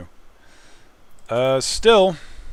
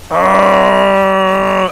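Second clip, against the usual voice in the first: startled shout; 7 semitones higher, 11 dB louder than usual. Real, not acted. Startled shout mid-fight.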